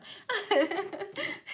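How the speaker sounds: a short, high burst of real giggling; high giggle. Real, not acted. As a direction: let a bright giggle escape. Laughs after the excited line.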